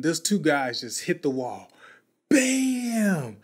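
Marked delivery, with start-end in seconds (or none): 2.3-3.4 high, punchy bam for the crash; loud bam. Retold or imitated. Storyteller mimics two fighters hitting the wall, punchy bam. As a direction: smack the word out like an impact. Imitating wall impact noise.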